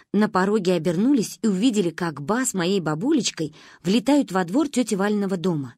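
usual speaking voice

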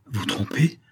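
whispered question; hushed disbelief. A narrator's reading. Saphir answers the vicomte's accusation in a hushed voice. Same words, hushed first take.

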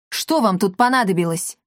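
ordinary delivery, nothing marked

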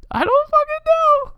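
very high, squeaky exclamation; baffled squeal. Real, not acted. Answering a startled co-host's "What?", baffled high squeal.

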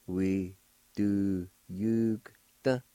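We do yugta stretched out slowly, silent O; slow teaching take of We do yugta; very slow demo. Shown as a demonstration. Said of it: "pronounce the G sound with the Y U like this".

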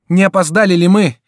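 high, loud eager call; calling out on arrival. A narrator's reading. Ally bursts in to help Bussy, loud high call. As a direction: call out as if rushing in.

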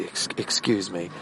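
soft, hesitant stammered excuse me; a quiet, faltering plea to a seatmate; timid excuse me. Retold or imitated. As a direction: ask meekly, barely daring to interrupt. Timidly asking seatmate to move.

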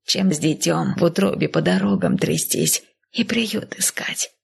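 aged, uneasy persuasion; old nanny. Acted. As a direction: reason gently as a doubtful old woman. Narrator voices old nanny.

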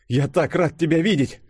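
high-pitched, delighted outburst; glad exclamation. A narrator's reading. Фадеев reunited with Юля, glad high exclamation. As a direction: exclaim with joy at seeing her. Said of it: "воскликнул Фадеев".

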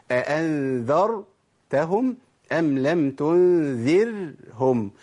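slowly, heavy ر in "أأنذرتهم", light ر in "تنذرهم"; still, sustained ر. Shown as a demonstration. Teacher showing the correct rule, slow recitation with lightly sustained ر. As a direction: hold each vowelless ر briefly in "أأنذرتهم أم لم تنذرهم". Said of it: "أسكنها وأبين زمن التوسط".

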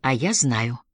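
low, held-back reply; stifled voice. A narrator's reading. Elinor tells Roddy she knows, in a stifled voice. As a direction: force it out through a constricted throat. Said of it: "проговорила Элинор сдавленным голосом".

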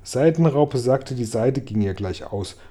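ordinary delivery, nothing marked

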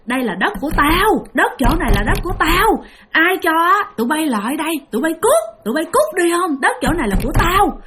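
high-pitched angry scolding of a menacing ghost woman; retold furious tirade, driving intruders off her land; angry cursing. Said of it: "Bả chửi nha".

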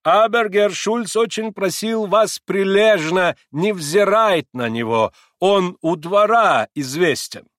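high, loud, slow kindly German; reassuring German accent. A narrator's reading. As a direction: coax him in broken Russian. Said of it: "ободрительно трепля старика по плечу".

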